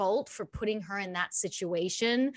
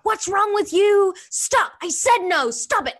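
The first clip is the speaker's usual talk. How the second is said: put-on much higher, louder furious yelling at a child; angry mother; 12 semitones higher, 9 dB louder than usual. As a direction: scold the kid, losing it in public. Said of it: "she was losing it".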